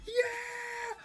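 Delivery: high, thin mock yell of a fan; imitated crowd yell. Vlogger mimics the convention crowd's yelling, high mock yell. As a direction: squeal it out like the excited crowd. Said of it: "all that yelling".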